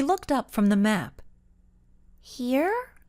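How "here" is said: high, puzzled question; confused exclaim. A narrator's reading. Carol at the alley, confused by the map. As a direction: blurt it out in disbelief. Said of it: "she exclaimed, her voice filled with confusion".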